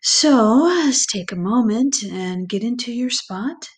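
normal talking voice

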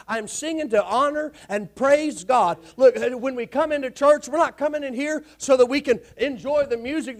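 loud, fired-up sermon; full-force preaching. Real, not acted. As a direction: preach it hard to wake the pews.

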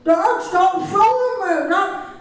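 put-on high, loud whining child; whiny kid voice. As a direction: shriek at dad like a bratty kid. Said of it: "we will play that for you now".

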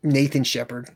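ordinary delivery

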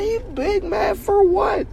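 squeaky, drawn-out mocking question; slow high-pitched incredulous aside; high mock disbelief. Retold giggly aside to lady.

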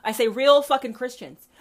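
normal talking voice

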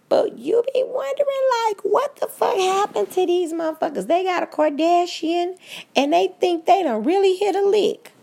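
squeaky high, incredulous voice; high-pitched mocking disbelief; high mock amazement.

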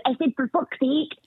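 high-pitched child imitation, Scottish accent; little Scottish girl. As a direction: repeat it as a toddler with a Scottish accent. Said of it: "she has a little Scottish accent".

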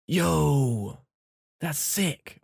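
rising, pumped-up "Yo, that's sick"; hyped yo. Shown as a demonstration. Showing a friend how to say yo when something is awesome, excited. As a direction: sell it like something amazing just happened. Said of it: "like that, you can say, like, different".

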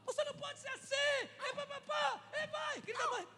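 high, mock-furious husband yelling. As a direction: play the shouting husband, hammed-up. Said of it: "ele gritava". (imitando o marido gritando, bem mais agudo)

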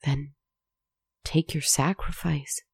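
soft, hopeless surrender. A narrator's reading. Girl to the monster, softly hopeless. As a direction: give up, quietly. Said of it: "she said hopelessly".

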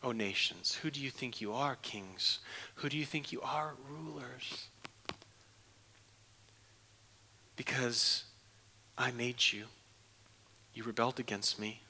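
low, soft, scornful whisper; derisive whisper. Acted. God speaking in derision to rebelling nations, whispered. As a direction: hiss contempt at rebellious kings. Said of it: "He's laughing in derision".

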